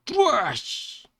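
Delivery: high, loud crashing noise with the mouth; explosive vocal bang; imitated crash. A narrator's reading. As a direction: burst out a crash effect. Imitates a crash mid-narration.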